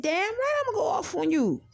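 high-pitched telling-off; retold going off. Going off on girlfriend over covid, scolding. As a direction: retell yourself laying into a friend, voice shooting up. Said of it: "you was gonna go off on me".

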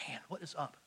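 whispering to himself on the trail. Retold or imitated. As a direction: breathe it out in puzzled awe. Wondering aloud at the shimmer.

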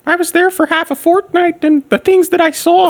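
solemn professor impression; Jordan Peterson impression. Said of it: "you just sounded like is fucking Jordan Peterson".

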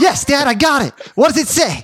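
lightly voiced boy answering; kid answering dad. Son answering dad from back seat, lightly voiced kid.

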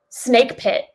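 ordinary talk, nothing marked

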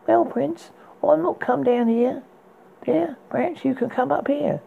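put-on a saucy girl teasing from her tower; young maiden voice. Rapunzel teases the prince from the window, high girlish voice.

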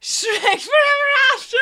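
high shouted disbelief; shocked disbelief. Real, not acted. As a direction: shriek the title in disbelief. Disbelief at friend's film.

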